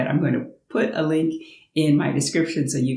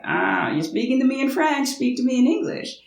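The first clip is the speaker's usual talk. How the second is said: high, whiny protest; imitating the friend; 10 semitones higher than usual. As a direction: play the flustered friend pitched high. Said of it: "my friend would be like".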